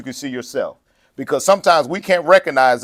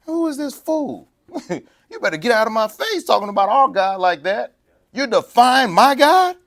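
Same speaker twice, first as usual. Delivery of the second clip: indignant anger; 8 semitones higher, 3 dB louder than usual. Retold or imitated. Preacher acting David facing the giant, high mock-angry challenge. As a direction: square up to the fool, half-playful. David confronting insult to God.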